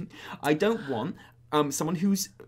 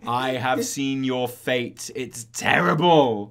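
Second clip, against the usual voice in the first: slow, loud ominous prophecy; doom-laden prophet; 3 semitones lower, 8 dB louder, much slower than usual. Retold or imitated. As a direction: intone it like a grim seer. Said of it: "what he says in the raid".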